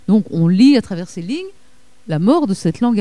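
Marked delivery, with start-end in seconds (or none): none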